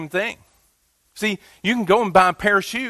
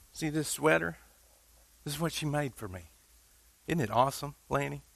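hushed proud showing-off; 4 semitones lower, 13 dB quieter than usual. Retold or imitated. People showing off Tabitha's sweater.

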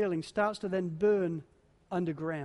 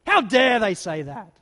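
angry retort; 8 dB louder than usual. Retold or imitated. Offended person's angry retort.

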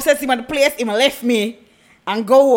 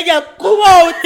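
louder, higher call to someone outside; 4 semitones higher, 6 dB louder than usual. Retold or imitated. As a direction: call her out of the house. Calling girlfriend out from outside.

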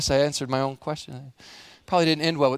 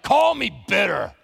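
bitter declaration; 2 semitones higher, 3 dB louder than usual. Retold or imitated. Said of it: "somebody like Naomi who's like".

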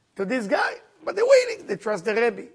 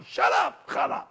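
higher, harsher shut up, Hannah; angry yell; 9 semitones higher than usual. Retold or imitated. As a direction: drunk husband lashing out at Hannah, shouting her down. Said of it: "getting very angry with her, saying".

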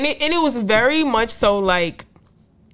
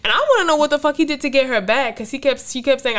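normal talking voice

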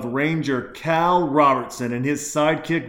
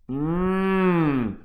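savoring mmm; 5 semitones higher than usual. Real, not acted. Savoring first beer sip.

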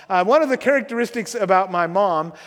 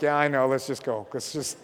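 embarrassed hushed mutter; 8 semitones lower, 6 dB quieter than usual. Retold or imitated. Embarrassed son hurrying friends away.

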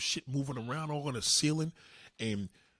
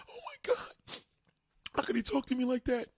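high, tearful imitation of a woman; mock crying; 11 semitones higher than usual. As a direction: whimper very high, like a hurt girlfriend. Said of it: "And she's crying".

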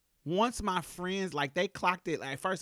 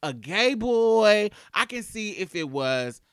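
loud, much higher indignant rant, stretching key words; friends ranting; 7 semitones higher, 10 dB louder than usual. Retold or imitated. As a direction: insist hard she's plainly a girl. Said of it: "This is what my friends are saying".

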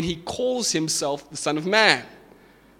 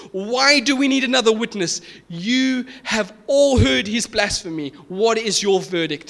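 outraged high priest; 7 semitones higher, 4 dB louder than usual. Retold or imitated. High priest to the council on hearing blasphemy, outraged and loud. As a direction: demand it in horrified outrage, pitch raised. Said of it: "He's outraged, he's in horror".

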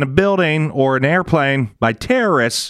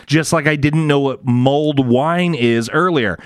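ordinary talk, nothing marked

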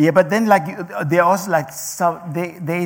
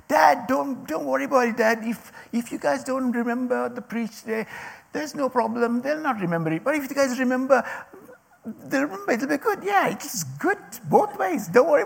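higher voice, lightly imitating an encouraging son; Luke encouraging; 8 semitones higher than usual. Son reassures his dad about the sermon, lightly imitated higher. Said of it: "Luke said today in the morning".

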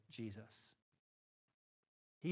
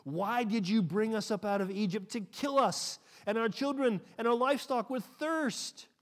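loud accusation; 7 semitones higher, 9 dB louder than usual. Acted. People accusing Moses, read aloud.

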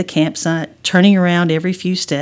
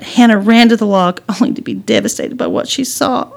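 ordinary delivery

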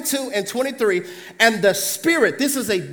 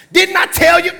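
higher, loud shout; exclaimed; 7 semitones higher, 7 dB louder than usual. Acted. King of Israel exclaiming to Jehoshaphat. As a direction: shout it in vindication.

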